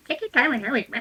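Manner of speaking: mock them under your breath like a bratty child; childish mocking. Player mimics the departing escorts' words, childishly mocking. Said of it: "clearly like the child that she is".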